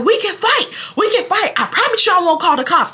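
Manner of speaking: high-pitched re-enacted challenge; retold screaming. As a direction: call it out high, goading an opponent. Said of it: "I was screaming".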